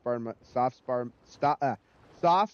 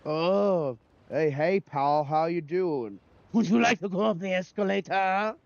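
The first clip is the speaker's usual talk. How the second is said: put-on French Canadian accent; 3 semitones higher than usual. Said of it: "the voice actors try".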